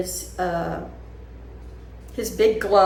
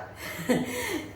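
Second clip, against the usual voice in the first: laugh; 13 semitones higher, 8 dB quieter than usual. Real, not acted. Laughs at herself.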